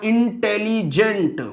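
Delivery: "इंटेलिजेंट" slowly split as इन-टे-लि-जेंट; broken into syllables. Shown as a demonstration. Teacher counting syllables for class, "इंटेलिजेंट" broken up slowly. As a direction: sound out "इंटेलिजेंट" piece by piece. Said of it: "जिनको तोड़कर".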